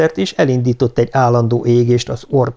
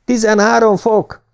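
much higher, moderately raised call; high-pitched, restrained shout; called-out report; 12 semitones higher than usual. A narrator's reading. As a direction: call the reading out from your seat. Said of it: "kiáltotta Johansen a székből".

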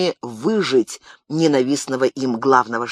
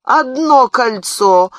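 outraged cry, higher, louder and slow; indignant; 9 semitones higher, 6 dB louder, much slower than usual. Acted. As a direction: drag it out in scornful disbelief. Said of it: "возмутился привратник".

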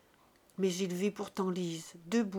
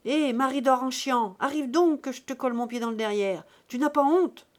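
much louder and higher, rising to a shrill cry at the end; yelling; 3 semitones higher, 7 dB louder than usual. A narrator's reading. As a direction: scream at her sister from the doorway. Lise yells at her sister. (hurlement de Lise)

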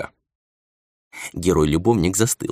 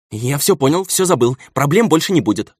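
rattled off in one breath, higher; rapid patter; 7 semitones higher than usual. A narrator's reading. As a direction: blurt it all out fast, scared. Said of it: "скороговоркой выпалил он".

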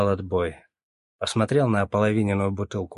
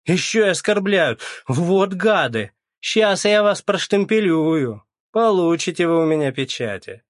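enraged; 9 semitones higher, 5 dB louder than usual. A narrator's reading. Оля enraged at the queue.

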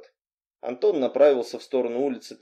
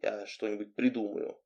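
hushed, much softer, low promise; whispered; 4 semitones lower, 11 dB quieter than usual. A narrator's reading. Anton secretly promising the heroine a plan, whispered. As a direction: murmur it secretly, very soft. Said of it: "шепнул".

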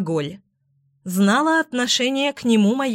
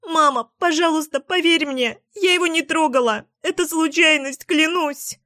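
high, tearful desperate pleading; hysterical crying; 8 semitones higher than usual. A narrator's reading. Daughter begs her mother to believe her, crying hysterically. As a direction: plead through tears, voice pitched way up. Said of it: "Я истерично разревелась и закричала".

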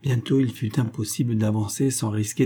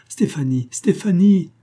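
first call, then a much higher, louder cry; shaking her awake, calling louder and louder; calling out her name; 7 semitones higher, 5 dB louder than usual. A narrator's reading. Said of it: "en criant".

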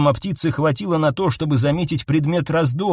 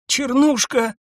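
moaning call; 10 semitones higher, slower than usual. A narrator's reading. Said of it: "простонала Ниса".

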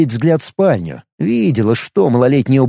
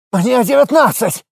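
high squeaky yelp from behind; squealing youth; 9 semitones higher than usual. Acted. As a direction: yap it out like a cornered brat. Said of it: "вякнул".

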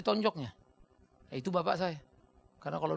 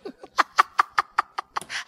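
unvoiced amused laugh; breathy laugh; 10 dB louder than usual. Real, not acted. Preacher laughing at his father's story, unvoiced laugh. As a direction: laugh at the memory.

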